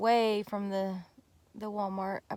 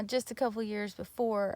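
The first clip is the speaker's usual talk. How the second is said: ordinary delivery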